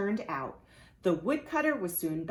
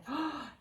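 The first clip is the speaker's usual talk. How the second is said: gasp; 9 semitones higher, 5 dB quieter than usual. Acted. Reader reacts to wolf dressing up.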